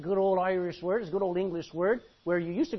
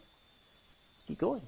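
usual speaking voice

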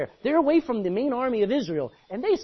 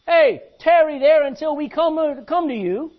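loud, high taunting shout; loud soldier call to stay put; enemy calling out; 8 semitones higher, 10 dB louder than usual. Acted. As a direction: yell it down across the valley. Philistines calling down.

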